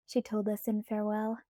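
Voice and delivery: plain reading voice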